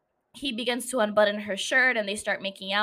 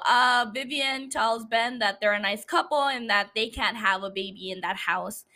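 normal talking voice

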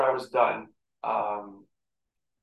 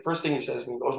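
ordinary talk, nothing marked

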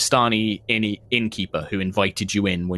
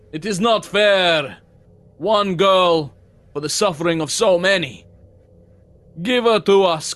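well-dressed mob spokesman; 7 semitones higher than usual. Acted. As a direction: call out an urgent public demand in character.

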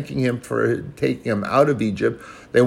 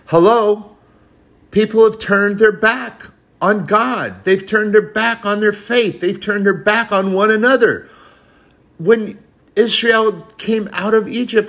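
fiery preaching; 9 semitones higher than usual. Real, not acted. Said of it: "preaching at full force".

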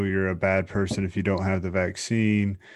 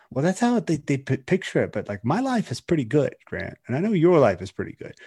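lightly raised, chirpy wrap-up line; upbeat sign-off voice; 6 semitones higher than usual. Pretend. Host sketching a cheerful podcast ending for Grant, pitched up. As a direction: play it as a breezy, bright podcast closer. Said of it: "we finish this up with, like".

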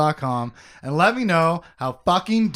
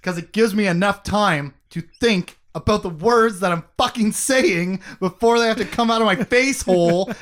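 loud, raised staccato, word by word; weird staccato rhythm; 5 semitones higher, 5 dB louder than usual. Shown as a demonstration. Host buying time to think, exaggerated staccato rhythm. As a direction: punch out each chunk separately, pitched up. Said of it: "in this weird staccato rhythm".

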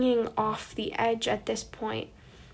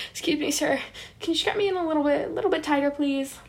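nervous polite plea; 6 semitones higher, faster than usual. Retold or imitated. Asking the ride operator to tighten the straps.

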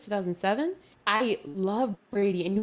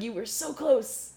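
teasing grandpa; 5 semitones higher, 8 dB louder, slower than usual. Retold or imitated. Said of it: "I'm just like".

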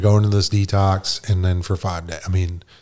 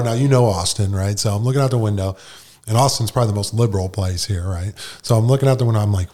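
ordinary talk

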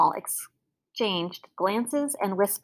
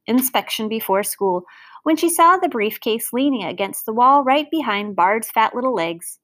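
plain reading voice

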